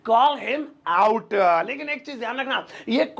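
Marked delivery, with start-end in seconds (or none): 0.1-1.3 very slow, each word laid out; slow teaching repeat; slow drill. Shown as a demonstration. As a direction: spell it out slowly for learners. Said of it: "आप इसे English में बोलेंगे".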